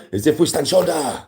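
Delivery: hammed-up odd slogan voice; weird delivery. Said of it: "I said that in a weird way".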